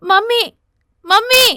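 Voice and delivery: loud furious call of mummy, pitched high; a child shouting for her mum in a rage; angry shout. Acted. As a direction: yell for her mother in anger. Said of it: "కోపంతో గట్టిగా".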